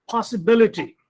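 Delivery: Possibility as a drill word peaking on BIL; third-from-end stress. Shown as a demonstration. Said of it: "put stress on the third syllable".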